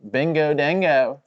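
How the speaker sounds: slow, drawn-out mock Aussie accent; light put-on Aussie accent. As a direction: ham up an Aussie accent reading a fan name. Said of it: "so that I'll have an Aussie accent".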